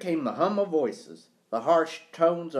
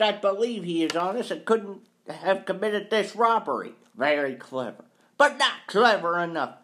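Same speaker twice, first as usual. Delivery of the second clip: higher, insistent, indignant employer; scornful accuser; 6 semitones higher than usual. Acted. Employer declares the caretaker guilty, scornful. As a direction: build the case with scorn.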